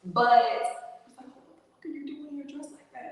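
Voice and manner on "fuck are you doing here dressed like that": softly, fast, half under breath; a friend's startled hushed aside; hushed retold question. Friend hushed, surprised at outfit.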